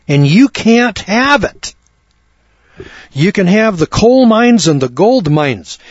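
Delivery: fiery sermon climax; preaching at full force. Acted. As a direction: hammer each item home from the pulpit. Preaching climax, list of jobs.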